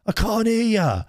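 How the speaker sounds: high, mimicked hard-of-hearing call; shouting old boy. Delivery man who cannot hear, mock old-boy shout. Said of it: "He's shouting like, like he's an old boy".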